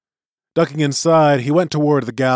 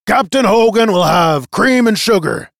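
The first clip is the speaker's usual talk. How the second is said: loud, raised shout from the deck; calling out; 9 semitones higher, 9 dB louder than usual. A narrator's reading. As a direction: holler the order to someone below. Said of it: "called Bailey from the deck".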